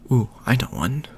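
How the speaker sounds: plain reading voice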